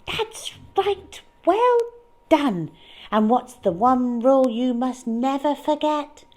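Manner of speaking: high, bright mouse teacher voice. Acted. Mouse teacher quizzing the little mice, high and pleased. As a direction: praise them, then quiz them like a teacher.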